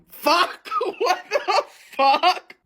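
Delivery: laughing disbelief, high; laughing outburst. Real, not acted. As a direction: crack up at the filter. Filter rejects her word.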